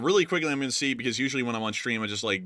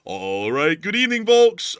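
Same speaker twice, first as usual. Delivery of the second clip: louder, higher imitation of a co-host's opener; mock show greeting; 7 semitones higher, 9 dB louder, slower than usual. Co-host teasing his partner's opener, mock announcer greeting. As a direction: belt out a copied catchphrase greeting.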